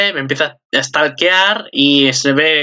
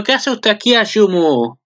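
Prendefuegos confronting; 6 semitones higher than usual. Retold or imitated. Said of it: "aparece la Prendefuegos diciendo".

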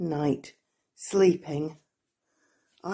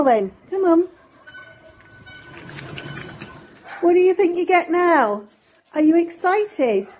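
excited pet talk; 7 semitones higher, 3 dB louder than usual. Real, not acted. Hyping Poppy for her treat and a kiss, high excited pet voice. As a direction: build the dog's excitement.

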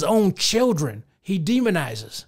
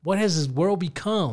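ordinary talk

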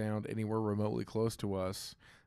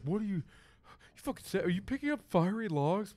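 higher, mocking disbelief; incredulous quoted buddy; 7 semitones higher than usual. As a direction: play the baffled friend, pitched up. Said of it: "he was just like".

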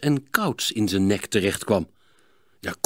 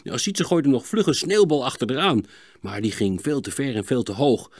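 ordinary delivery

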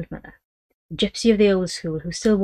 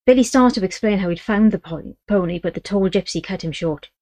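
ordinary delivery

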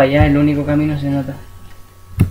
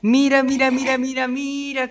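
high mocking; 8 semitones higher, 7 dB quieter than usual. Real, not acted.

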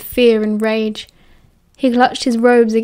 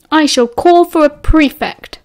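higher, louder haughty voice; indignant threat; 5 semitones higher, 5 dB louder than usual. A narrator's reading. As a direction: threaten to report them, all indignation. Haughty threat through the door.

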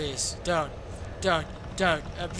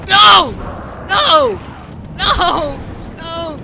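loud desperate no; panicked shouting; 8 semitones higher, 14 dB louder, much slower than usual. Real, not acted. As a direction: yell it in terror. Enemy attacking in game.

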